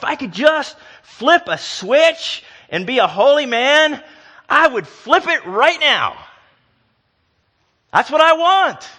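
loud, high, urgent preaching; full-force preaching. Acted. Preacher urging the congregation about waiting, full force. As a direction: drive it home to the pews.

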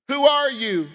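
high, loud "Who are you?" with "are" punched; pressing question. Shown as a demonstration. Preacher repeatedly pressing the congregation: who are you. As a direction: demand it with "are" stressed. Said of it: "If I were to say".

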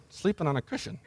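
very soft, almost under the breath; hushed, knowing delivery; sly little aside. Acted. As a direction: slip it in as a tiny hushed aside. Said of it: "almost says that with a little".

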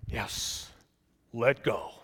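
a hint of a low God voice. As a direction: calmly confirm the order. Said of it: "he hears a deep voice".

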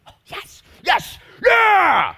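ecstatic yell, high and loud; joyful shout. Retold or imitated. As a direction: cheer like a fan whose team scored. Jesus cheering, fired up.

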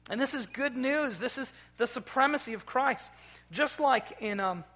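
fervent sermon crescendo; preaching at full force. Acted. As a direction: ring it out over the congregation. Building to the good news.

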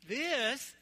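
loud, high reveal of the map; showman's ta-da; big reveal. Real, not acted. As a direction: hold it up and announce it. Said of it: "showing the paper map".

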